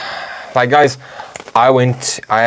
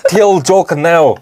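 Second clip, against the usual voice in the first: slow, higher mock Russian accent; Putin impression; 4 semitones higher, slower than usual. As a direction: draw out the order in hammed-up Russian English. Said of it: "Putin himself will come, like".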